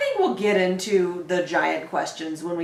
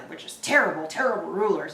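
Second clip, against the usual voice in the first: ordinary delivery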